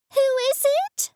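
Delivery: put-on high, quick screeching old mum. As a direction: screech the question down the phone. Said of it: "she screeched".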